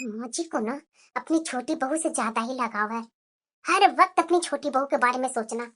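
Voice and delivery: muttering low under her breath. Acted. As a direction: grumble softly to herself so the mother-in-law barely hears. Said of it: "अरे बुदबुदा मत". (बड़बड़ाते हुए धीमी आवाज़ में)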